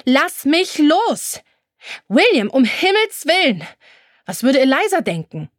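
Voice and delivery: panicked shriek for release; screaming. A narrator's reading. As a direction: scream at him to let go. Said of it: "fing dann an zu schreien".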